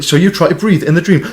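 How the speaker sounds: ordinary talk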